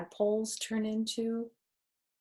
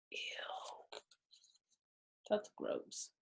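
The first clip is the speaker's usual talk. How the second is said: plain reading voice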